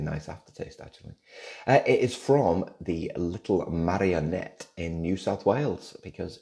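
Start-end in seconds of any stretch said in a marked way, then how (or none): none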